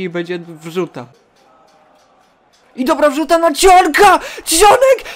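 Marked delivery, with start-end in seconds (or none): 2.8-5.0 very high, loud commentary yell; excited shouting. Real, not acted. Gamer willing Cionek to score from the cross, excited shouting. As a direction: scream the player's name as the cross lands. Cross reaches player before goal.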